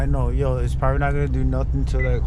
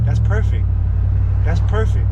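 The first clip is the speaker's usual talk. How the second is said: higher and bright-voiced praise; delighted; 7 semitones higher, 4 dB louder than usual. Real, not acted. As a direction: beam through the praise. Delighted with sound.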